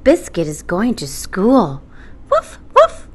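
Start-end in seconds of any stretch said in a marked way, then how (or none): 2.3-3.1 high squeaky puppy woofs; puppy bark. Acted. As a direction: yip like a little puppy. Puppy barks.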